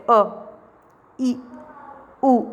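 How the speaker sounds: अ, ई, उ each clipped short; short vowels. Shown as a demonstration. Teacher showing students short अ, ई, उ. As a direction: say अ, ई, उ quick and brief, no stretching. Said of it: "बिल्कुल थोड़ा सा".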